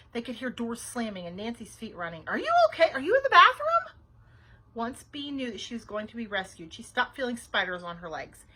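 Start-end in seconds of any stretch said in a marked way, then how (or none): 2.3-4.0 high, loud frantic questions; freaked-out yell. A narrator's reading. Babysitter running through the house, yelling in panic. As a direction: yell it urgently, pitch way up. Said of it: "hear Nancy freak it out".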